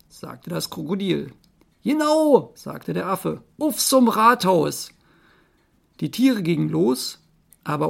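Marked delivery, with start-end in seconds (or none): 1.8-2.5 high, loud monkey agreeing. Acted. Monkey agrees with the crocodile, high and loud. 3.6-4.9 high, loud, drawn-out call; rally the troop as a monkey; monkey rallying cry. Acted. Monkey sends animals to the town hall, calling loudly.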